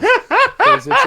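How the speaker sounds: very high, loud laughing speech. Real, not acted. Friend teasing about Frieren talk, laughing hard. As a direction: squeeze the words out through a big laugh. Cracking up at his own comparison.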